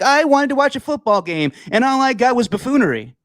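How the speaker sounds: high-pitched complaining fan impression; high, indignant mock complaint; mock outraged fan. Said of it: "some person to be like".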